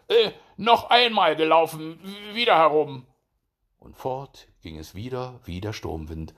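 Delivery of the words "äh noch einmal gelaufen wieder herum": loud, high hare shout; flustered hare, voice raised and high; calling out. A narrator's reading. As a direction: shout the order to race back. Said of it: "Er rief".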